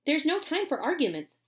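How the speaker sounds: cried. Acted. Wonka hurries everyone along, eager cry. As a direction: brush it aside briskly. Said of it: "cried Mister Wonka".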